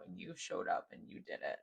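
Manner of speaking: soft whispered affirmation. Real, not acted. Tender affirmation to viewer.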